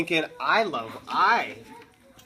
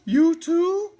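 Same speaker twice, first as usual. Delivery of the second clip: higher, louder surprised question; Cherry Sue's voice; 6 dB louder than usual. A narrator's reading. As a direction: ask in a much higher Cherry Sue voice. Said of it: "said Cherry Sue".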